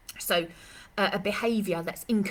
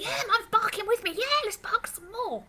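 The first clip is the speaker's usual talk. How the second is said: very high gleeful dog voice; voicing the dog; 3 dB louder than usual. Dog cheering that mum barks too, very high and excited. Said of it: "They're like".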